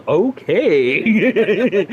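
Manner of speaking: loud, laughing okay. Acted. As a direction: laugh it out, high. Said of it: "and then Ursa goes".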